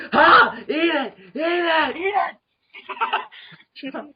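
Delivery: very high, hammed-up yelling; frantic high mock cries; imitated shouting. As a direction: shriek it like a blaring speaker. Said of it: "just like".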